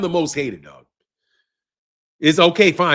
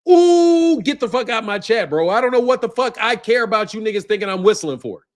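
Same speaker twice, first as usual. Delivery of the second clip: normal talking voice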